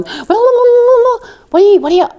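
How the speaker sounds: high, loud jabber imitating two people talking at you; mock babble. Two girls in the mess line going at the newcomer, mock babbling. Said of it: "they're both looking at me going".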